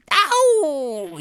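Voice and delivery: high, loud howl of pain; drawn-out falling "ow"; long pained yell. A narrator's reading. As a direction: yelp as the cane lands. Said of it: "yelled Thwaites".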